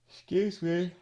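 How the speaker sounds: sleepy yawn mid-sentence. Real, not acted. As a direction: yawn out an apology. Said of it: "I don't know why I'm yawning".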